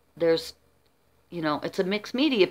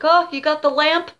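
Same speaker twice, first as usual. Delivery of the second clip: high, loud surprised husband; 9 semitones higher, 6 dB louder than usual. Retold or imitated. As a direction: play the husband, caught off guard. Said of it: "he's like".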